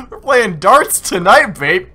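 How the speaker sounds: gleeful gloating. Real, not acted. Gloating after the hit.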